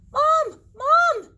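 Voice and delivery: high, loud frantic yell; panicked scream. Acted. Bunny screaming for his mom in panic. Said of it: "Jasper screamed".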